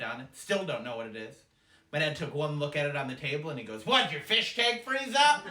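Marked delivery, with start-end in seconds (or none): 3.9-5.5 louder, higher, drawn-out dad voice; mock-baffled dad; incredulous dad jab. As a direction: deliver a loud, slow dig as the dad. Dad mocks the relatives' dish.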